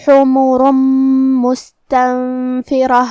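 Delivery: slow, drawn-out tajweed chanting; Quranic recitation. Shown as a demonstration. Teacher reciting the verse for students, slow melodic chant. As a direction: intone each verse slowly and melodically.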